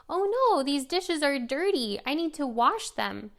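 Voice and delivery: playful parentese. Shown as a demonstration. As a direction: invite the toddler to help with the dishes. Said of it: "You can say".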